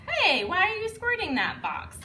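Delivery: high, loud storybook call; playful shout. Shown as a demonstration. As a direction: call out across the page. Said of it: "it says".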